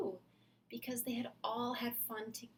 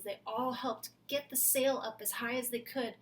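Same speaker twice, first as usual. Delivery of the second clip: plain reading voice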